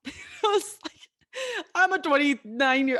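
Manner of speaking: real laugh, pitched high; bursting into a laugh mid-sentence. Real, not acted. Telling listeners about crying to her coach, real laughter.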